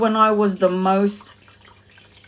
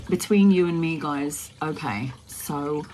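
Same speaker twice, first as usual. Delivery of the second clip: hushed aside; 5 semitones lower, 6 dB quieter than usual. Real, not acted. Telling the viewers a secret, hushed and confiding. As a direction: drop to a near hush, confiding.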